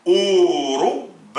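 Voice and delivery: Ourouba with a long stretched ou; drawn-out Arabic word. Shown as a demonstration. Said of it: "ou longue voyelle".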